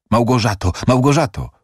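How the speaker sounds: urgent call to a loved one; calling out loudly. A narrator's reading. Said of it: "Faust głośno".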